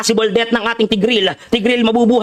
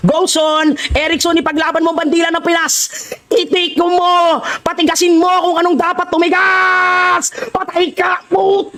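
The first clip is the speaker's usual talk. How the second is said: high-pitched battle yelling; excited cheering shout; 7 semitones higher than usual. Real, not acted. Streamer urging Erickson to fight for the flag, shouting. Cheering teammate on in live match.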